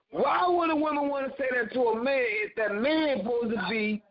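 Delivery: ordinary delivery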